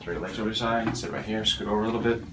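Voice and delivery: ordinary delivery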